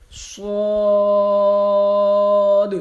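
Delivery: full-mouthed long صاد; heavy long Suad. Shown as a demonstration. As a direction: open wide and drag out صاد. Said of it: "آخر پہ دال کی آواز آئے".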